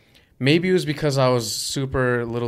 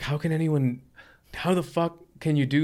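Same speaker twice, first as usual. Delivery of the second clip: higher-pitched retold disbelief; retold amazement; 5 semitones higher than usual. Young skater watching a huge wall ride, retold in amazement. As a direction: relive your amazement with raised pitch.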